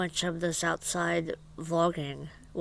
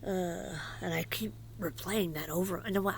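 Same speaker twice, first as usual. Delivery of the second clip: ordinary talk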